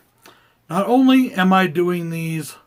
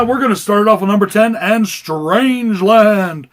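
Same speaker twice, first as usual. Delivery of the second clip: ring announcer; 5 semitones higher, 9 dB louder than usual. Acted. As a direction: hype it like a boxing announcer. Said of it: "Is this voice getting annoying yet?".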